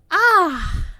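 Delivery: sudden high loud "ah"; surprised exclamation. Real, not acted. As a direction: yelp it out.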